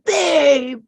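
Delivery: high, loud mock old-lady croak off a lifetime of cigarettes; old smoker woman. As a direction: call out babe like an eighty-year-old chain smoker. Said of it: "she sounded like an eighty-year-old woman".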